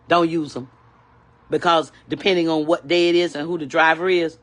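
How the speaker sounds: ordinary delivery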